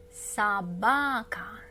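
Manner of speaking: slow careful repeat; slow model word. Shown as a demonstration. Teacher repeating the word slowly for learners. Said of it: "Try to read it first".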